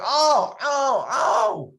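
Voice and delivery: high, loud chorus of oh; surprised exclaiming. Retold or imitated. Residents crowding round a freshman's bite, loud surprised oh. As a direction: gasp in amazement at the bite.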